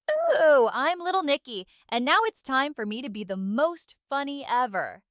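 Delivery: ordinary talk, nothing marked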